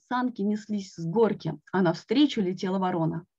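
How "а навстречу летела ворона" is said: plain reading voice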